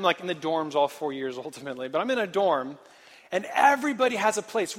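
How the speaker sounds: ordinary talk, nothing marked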